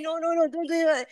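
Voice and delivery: high, rushed "No, no, no, don't do that" with each no piling on; flustered scolding. Shown as a demonstration. Teacher acting the wrong way to scold a pet, flustered. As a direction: babble a string of panicky "no"s. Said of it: "But if you say".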